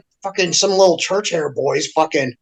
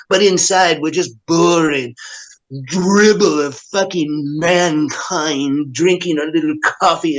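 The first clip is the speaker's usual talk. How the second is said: mocking self-important hipsters; 4 semitones lower than usual. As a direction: drag it out as a smug parody. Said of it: "these motherfuckers act like".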